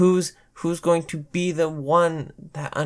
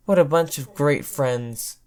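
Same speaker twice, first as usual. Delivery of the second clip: ordinary delivery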